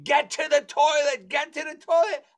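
high, urgent retold yell; mock screaming. Host screaming at his vomiting guest, mock screaming. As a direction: yell it high, as an exasperated order. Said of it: "And I'm screaming".

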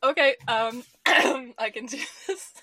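giggling through the words; can barely hold it together; laughing. Real, not acted. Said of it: "If you could see me, I was just, like, holding my whole face".

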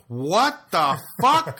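drawn-out, stunned, loud "what the fuck" with a laugh in it; incredulous outburst. Retold or imitated. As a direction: disbelief that something so petty was said. Retold street confrontation, stunned reply.

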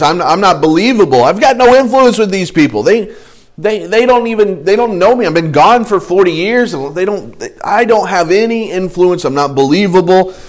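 insistent protest. Acted. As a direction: plead the case to God as Moses. Moses pleads his lack of influence.

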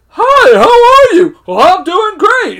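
very high, loud, drawn-out greetings; two vaudeville voices. Retold or imitated. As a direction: play both sides of a hammed-up exchange, slowly. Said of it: "like, the two voices".

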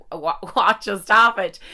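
half-laughing imitation of shouting upwards; mock shouting. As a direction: retell your own bawling outburst with a laugh. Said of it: "me shouting up at the sky".

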